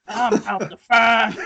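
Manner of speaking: slow, cartoony Southern drawl; hammed-up Southern detective. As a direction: draw the words out like a Southern caricature. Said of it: "his character's kinda cartoony".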